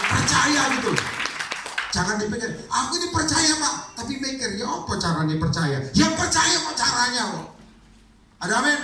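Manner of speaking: high impassioned pulpit call; fiery preaching. Acted. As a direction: rouse the congregation to answer. Calling out to the congregation.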